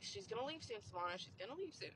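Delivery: very soft talk to oneself; hushed inner pep talk; quiet self-reassurance. Retold or imitated. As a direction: soothe yourself under your breath. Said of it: "I just keep telling myself".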